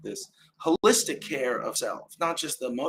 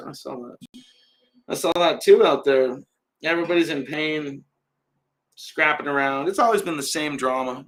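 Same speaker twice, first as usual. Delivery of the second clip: normal talking voice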